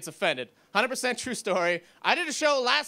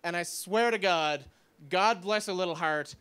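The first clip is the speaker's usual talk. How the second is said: usual speaking voice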